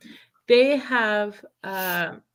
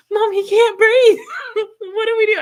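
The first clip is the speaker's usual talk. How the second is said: much higher and louder, laughing through mock panic; panicked child; 13 semitones higher, 8 dB louder than usual. Son crying out to Mom about the trapped elf, much higher and louder in laughing panic. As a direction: squeal it like a kid sure his elf is suffocating.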